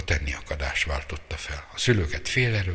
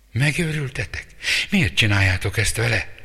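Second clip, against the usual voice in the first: horrified outcry; 6 semitones higher, 4 dB louder than usual. Acted. Sister horrified at family.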